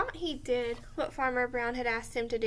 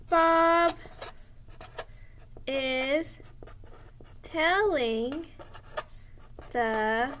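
slow dictation; much slower than usual. Shown as a demonstration. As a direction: dictate so kids can write along. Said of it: "writing a complete sentence with me".

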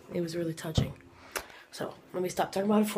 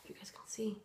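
awed whisper over the gift; 4 semitones lower, 16 dB quieter than usual. Real, not acted. Showing viewers a gift's color, in an awed whisper. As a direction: hush it like a secret you can't believe.

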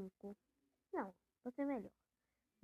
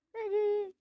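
loud yelp, much higher; frustrated shout; 11 semitones higher, 14 dB louder than usual. Real, not acted. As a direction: cry out at losing. Loses a level in game.

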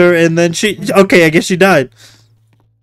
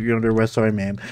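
much lower and softer, rushed retelling of the cop; mock cop; 10 semitones lower, 12 dB quieter, much faster than usual. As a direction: mumble it fast as a throwaway imitation. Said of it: "He literally went from".

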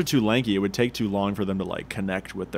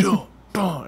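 high, loud-ish mimicked thumps; drawn-out mock thud noises; imitated thuds; 10 semitones higher, 4 dB louder than usual. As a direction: imitate things thudding through the wall. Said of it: "I could hear, like".

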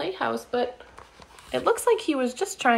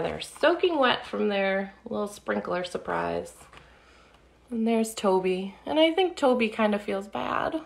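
ordinary delivery

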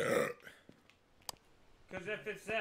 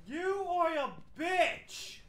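slow, high, drawn-out taunt; taunting shout; 9 semitones higher, 7 dB louder, much slower than usual. Pretend. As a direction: goad the ghost loudly, stretching the words. Taunting the ghost to provoke it.

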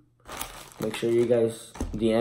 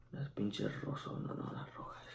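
whispering; 2 semitones lower, 19 dB quieter than usual. Real, not acted. Aside to someone off camera.

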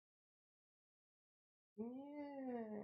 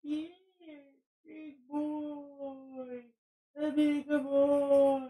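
talking to a dog; 12 semitones higher, much slower than usual. Real, not acted. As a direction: draw it out cooing at the dog. Cooing at dog.

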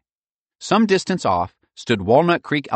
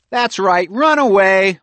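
triumphant higher, louder taunt; calling out; 12 semitones higher, 9 dB louder, slower than usual. A narrator's reading. Michael calls after the fleeing bullies, taunting. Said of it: "Michael called out".

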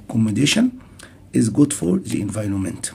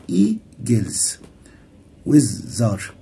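"eagles، وزر" each broken into two syllables; much slower than usual. Shown as a demonstration. Teacher showing two-syllable words, syllable by syllable. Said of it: "كلمات ذات مقطعين زي".

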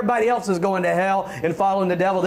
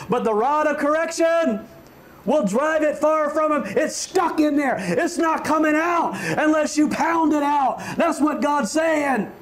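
fiery preaching; 7 semitones higher than usual. Acted. Preacher to church parents on discipline, full-force shout. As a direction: hammer it in from the pulpit.

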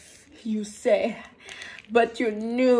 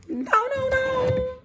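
squeaky protest; slower than usual. Pretend. Voices character protesting.

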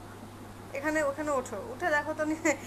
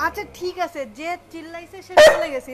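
loud announcing; 11 dB louder than usual. Pretend. Telling child the shouter left.

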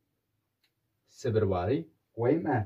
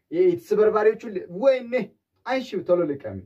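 mock alarmed; 9 semitones higher, 5 dB louder than usual. Urging to pick up shards.

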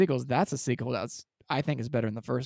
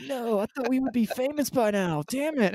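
high-pitched, whiny comic protest; hammed-up frustrated outburst; mock dismay; 10 semitones higher than usual. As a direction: act out exaggerated disappointment, pitched way up. Voices failed musicians' dismay at open mic.

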